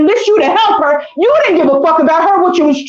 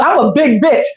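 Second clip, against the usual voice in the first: usual speaking voice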